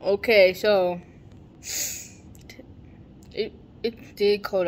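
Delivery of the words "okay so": usual speaking voice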